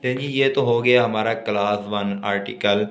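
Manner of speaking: usual speaking voice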